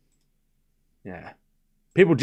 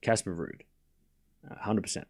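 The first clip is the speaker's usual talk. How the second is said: usual speaking voice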